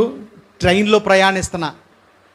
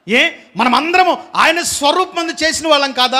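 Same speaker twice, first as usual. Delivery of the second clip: high, urgent preacher's appeal; fervent preaching; 4 semitones higher than usual. Acted. Preacher challenging the congregation, fervent and raised. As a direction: press the congregation with each question.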